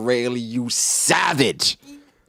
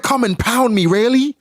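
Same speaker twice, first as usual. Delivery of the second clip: high, lilting falsetto as Shaki; hammed-up female voice, rising on Rayleigh; mock seductive woman; 10 semitones higher than usual. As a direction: purr it up high like a flirty woman. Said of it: "She said".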